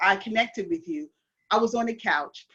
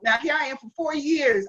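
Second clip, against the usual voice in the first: normal talking voice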